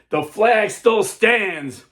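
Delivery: slow, high, loud proclamation of victory; triumphant cry. Acted. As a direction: ring it out slowly, high and triumphant. Dawn, flag seen still standing.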